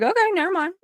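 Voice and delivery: high, breezy brush-off; dismissive. Retold or imitated. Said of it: "I was like".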